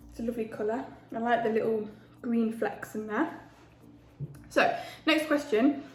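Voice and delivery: ordinary talk, nothing marked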